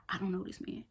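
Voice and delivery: hushed whisper to the camera; whispered aside. Real, not acted. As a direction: drop to a secret whisper.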